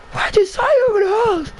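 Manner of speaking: high falsetto mock screech; screaming teacher. Teacher questioning student about the halls, mock screaming. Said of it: "She's screaming at me".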